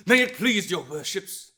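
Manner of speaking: fervent orator at full voice; impassioned oratory. Acted. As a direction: drive it forward phrase by phrase, loud and high. Impassioned defense oration in court.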